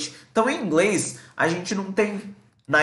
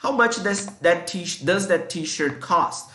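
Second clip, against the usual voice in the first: slow example read; 2 semitones higher, slower than usual. Shown as a demonstration. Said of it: "pra perguntar sobre preço".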